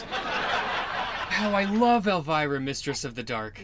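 femme fatale. Pretend. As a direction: purr it like a horror hostess. Fan gushing Elvira's dramatic intro.

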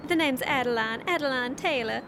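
drawling Southern belle voice; Southern accent. Acted. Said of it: "Are you serious? You're Irish".

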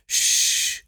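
a sharp, loud hissed shush; a loud librarian shush. Retold or imitated. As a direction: hush him with a harsh shh. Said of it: "just goes".

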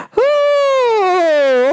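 long, high falling hoot; high sustained exclamation; high drawn-out whoo. Retold or imitated. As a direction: hold the note high, then slide down. Repeats the whoo after sound effect.

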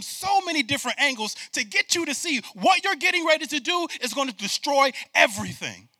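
loud, high preaching, driving the point; preaching at full force. Real, not acted. Pastor preaching hard to the congregation, loud and high.

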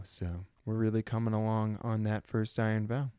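ordinary delivery

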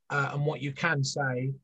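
ordinary talk

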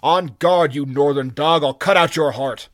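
loud furious threat; furious shout. Acted.

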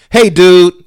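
high, loud goofy greeting; uncle's greeting. Retold or imitated. Nephew mimicking his goofy uncle saying hello, high and loud. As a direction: belt it out goofy and bright-hearted. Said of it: "That's how he sounds".

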